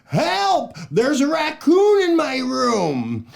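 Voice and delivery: high, loud panicked yell; yelled refrain. Shown as a demonstration. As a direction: yell it like you found a raccoon. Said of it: "Can you yell it out".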